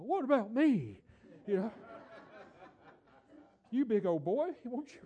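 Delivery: high, loud comic whine; hammed-up what about me; mock protest. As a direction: play it up as a hurt joke. Joking self-pity, high loud.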